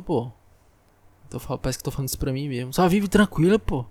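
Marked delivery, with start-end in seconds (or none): 2.7-3.9 louder, higher repeat of the advice; loud self-advice. Acted. Vlogger repeating his own calm advice to himself, loudly. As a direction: shout the advice at yourself.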